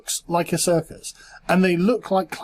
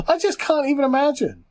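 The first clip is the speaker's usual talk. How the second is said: ordinary delivery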